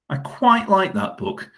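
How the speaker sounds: drawn-out "quite" in "I quite like that book". Shown as a demonstration. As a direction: lean slowly on "quite" in "I quite like that book".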